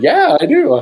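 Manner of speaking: high, loud, excited yes; eager retold reply. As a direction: blurt it out eagerly, pitch leaping up. Retold eager answer to guide.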